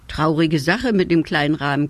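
ordinary delivery, nothing marked

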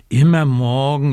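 loud whiny complaint, mildly acted; whining raven. Little raven whining to Frau Dachs about his birthday, loud whine. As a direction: whine it like an impatient child. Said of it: "quengelt Socke".

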